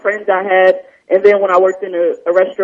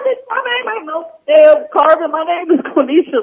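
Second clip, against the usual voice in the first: high-pitched, louder mock outrage; indignant retort; 9 semitones higher, 5 dB louder than usual. Student retelling retort to teacher over Spanish name, indignant. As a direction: push back in exaggerated, high-pitched protest.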